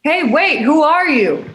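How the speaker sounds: yelling after them. Acted. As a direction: shout after them as they leave. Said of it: "throws up her hands to yell at them".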